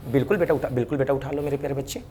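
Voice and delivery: ordinary talk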